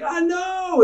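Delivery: high, shouted delight; a child squealing with pride; excited toddler. Retold or imitated. Son answers proudly.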